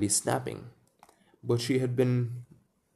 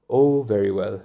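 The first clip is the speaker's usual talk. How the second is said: a louder, mildly huffy "Oh, very well"; a little nettled; 7 dB louder than usual. A narrator's reading. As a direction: brush it off, lightly irritated. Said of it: "a little nettled".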